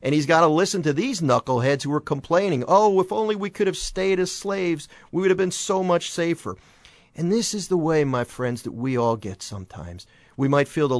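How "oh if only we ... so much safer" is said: higher, mock-whining voice of the grumbling Israelites; whiny complainers. Preacher imitating Israelites complaining to Moses, mock whine. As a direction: play the grumbler longing for the old days, hammed-up.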